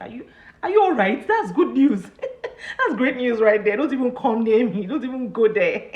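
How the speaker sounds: hammed-up happy outrage; laughing excitement. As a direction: tell her off through laughter. Retold laughing reaction to friend.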